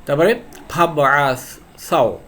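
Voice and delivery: ফাবআসাও said slowly with no hamza between ফা and বআসাও; drawn-out recital of ফাবআসাও; slow recitation. Shown as a demonstration. Teacher reading the Quranic word to students, slow recital. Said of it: "clear?".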